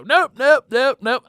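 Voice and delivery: high, loud repeated nopes; loud denial. Retold or imitated. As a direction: keep saying nope to the parents. Denying to parents.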